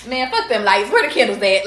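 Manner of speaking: loud, carefree comic outburst; imitating Cat Williams. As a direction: belt out the dismissive punchline. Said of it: "he was just like".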